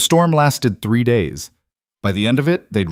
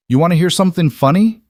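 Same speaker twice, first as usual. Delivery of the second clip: wry amused question; 8 semitones higher, 6 dB louder than usual. Acted. Wry question to frightened woman.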